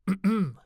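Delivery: a loud, high throat clear before speaking. Acted. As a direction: clear the throat sharply to get attention. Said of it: "Takahashi cleared his throat".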